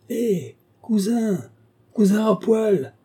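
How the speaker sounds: high, drawn-out hail; long, high-pitched shouts for attention; calling out. A narrator's reading. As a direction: call slowly to a cousin across a café. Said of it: "clamait monsieur Swift".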